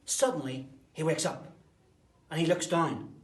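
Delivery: loud, punchy storyteller beats; sudden loud storytelling; dramatic loud build. A narrator's reading. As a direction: jolt the kids awake with each phrase.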